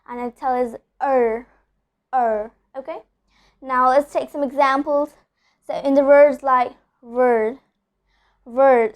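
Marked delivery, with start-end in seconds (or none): 1.0-2.5 er said with the tongue rolled for a full R; strong American R. Shown as a demonstration. Teacher demonstrating the American R. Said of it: "I personally roll it upwards". 7.0-8.9 word with a long rhotic er in the middle; strong R in word. Shown as a demonstration. Teacher demonstrating the R sound. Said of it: "I'm telling er here".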